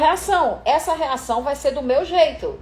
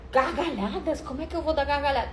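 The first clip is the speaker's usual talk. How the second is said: incredulous; 3 semitones higher, 8 dB quieter, faster than usual. Shown as a demonstration. Incredulous actress aside.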